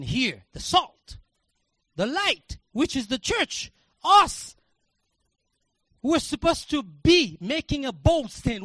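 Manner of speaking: loud, high fervent preaching shout; preaching at full force. Acted. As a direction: cry it out to the congregation, slow and loud.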